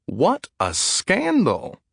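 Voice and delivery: high, slow, drawn-out gasp of scandal; a gossiping old lady, scandalized. A narrator's reading. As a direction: clutch your pearls and stretch it out. Gossiping old woman gasps.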